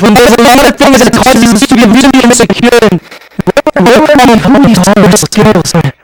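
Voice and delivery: rapid, high and loud pleading run; building urgency. Real, not acted. Preacher listing congregation's worries, urgent crescendo.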